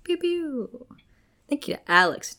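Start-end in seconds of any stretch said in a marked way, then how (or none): 0.0-0.9 squeaky laser pew pew; high "pew pew" sound effect. Pretend. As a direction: toss in a playful high laser noise. Playful cheer after patron name.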